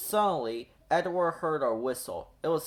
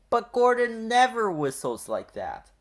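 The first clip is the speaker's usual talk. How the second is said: surprised; 8 semitones higher, 4 dB louder than usual. Acted. Surprised remark.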